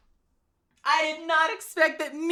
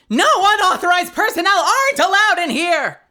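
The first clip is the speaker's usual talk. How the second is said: much higher, louder barking bailiff; Meekins voice; 7 semitones higher, 6 dB louder than usual. Acted. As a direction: bark orders like an overeager rookie bailiff. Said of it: "Am I doing the voice right?".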